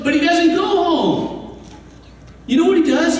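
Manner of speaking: ordinary talk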